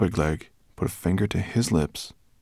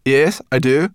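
put-on girl voice; 6 semitones higher, 10 dB louder than usual. Sorrel grins.

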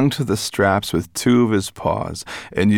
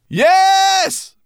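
high, drawn-out loud yes; called back; 7 dB louder than usual. A narrator's reading. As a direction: holler it back over the wind. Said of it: "Berber Chan called back".

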